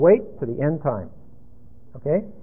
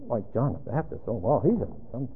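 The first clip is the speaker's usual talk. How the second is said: softer, lower, muttered disbelief; doubtful news photographer; 6 semitones lower, 9 dB quieter, faster than usual. Acted. Photographer doubting a passerby's answer, softer and lower. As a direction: brush him off and move on. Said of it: "the news photographer goes".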